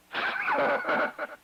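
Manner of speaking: short, very high cackle; taunting laugh. Real, not acted. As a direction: break into a quick high-pitched cackle at him. Laughing between taunts about tacos.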